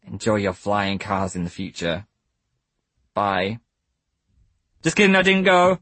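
put-on childlike voice; kid voice. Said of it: "what happened to your voice".

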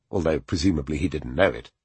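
ordinary delivery

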